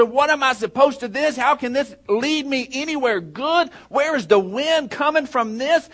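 high, urgent sermon delivery; fervent preacher pressing each question; impassioned preaching. Real, not acted. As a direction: cry out to the congregation with pitch held high. Preaches with rising fervor.

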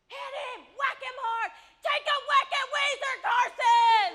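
loud high fan yelling; hammed-up ringside yelling. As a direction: egg him on at the top of your voice. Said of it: "I'm just yelling at Carson".

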